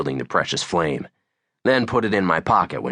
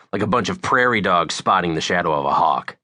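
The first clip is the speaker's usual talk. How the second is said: plain reading voice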